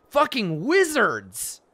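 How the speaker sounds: loud, high, slow disgust on "Fucking wizards"; disgusted outburst. Real, not acted. Player cursing all wizards, loud disgust. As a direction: spit out "Fucking wizards" loudly and slowly.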